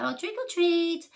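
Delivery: put-on high, loud kid's "trick or treat"; child's excited call. Child at the door calling trick or treat, excited. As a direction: sing it out like an eager kid at the door.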